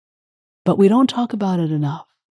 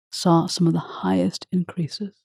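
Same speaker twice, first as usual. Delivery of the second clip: ordinary delivery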